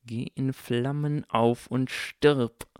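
slow, deliberate ill wish; seething curse. A narrator's reading. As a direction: spell out the curse through irritation. Harry curses gushing Draco.

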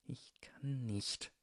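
slow, strained whisper. A narrator's reading. Draco admits to Harry he can't step in, whispering. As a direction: whisper it very softly, drawn out. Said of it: "flüsterte Draco".

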